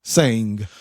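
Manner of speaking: plain reading voice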